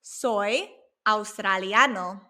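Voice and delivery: slow, evenly spaced "Soy australiano"; slow Spanish model. Shown as a demonstration. Teacher showing how to say you are Australian, slow model. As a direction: draw out "Soy australiano" carefully. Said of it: "if you want to say you are Australian".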